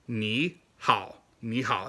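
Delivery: 你好 with clear tone sandhi; 你好 with correct tones; careful tones. Shown as a demonstration. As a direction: model 你好 carefully. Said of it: "Get those tones right".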